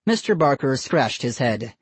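usual speaking voice

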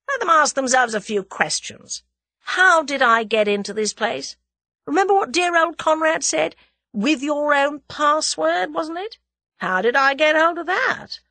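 high, loud, scornful questioning; scornful challenge. A narrator's reading. Captive argues with accusers, scornful. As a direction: challenge the fools with pointed questions.

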